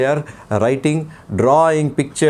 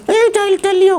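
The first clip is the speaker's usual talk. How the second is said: put-on puppet boy voice; 3 dB louder than usual. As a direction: play the cheeky little boy puppet. Puppet child voice.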